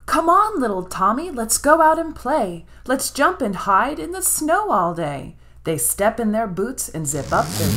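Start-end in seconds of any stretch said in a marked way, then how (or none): 0.1-5.4 put-on high, loud child calling; rally little brother to play; excited call. Ginger calls brother to play.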